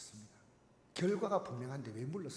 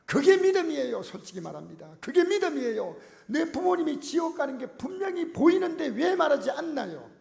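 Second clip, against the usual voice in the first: preached much higher and louder, almost shouting; fiery preaching; 11 semitones higher, 10 dB louder than usual. Acted. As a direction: hammer the point home to the congregation. Preaching at full force. (목청껏 외치는 설교)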